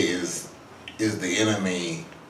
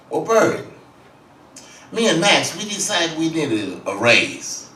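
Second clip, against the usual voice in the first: louder, higher, a bit pushy; Miles asking for a raise; 8 semitones higher, 6 dB louder than usual. Retold or imitated.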